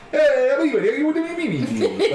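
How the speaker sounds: very high, loud cartoon Italian babble; Family Guy-style mock Italian. Sister trying to talk to Italians, hammed-up cartoon Italian accent. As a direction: go over the top with squealed Italian gibberish. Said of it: "Like, like fucking Family Guy".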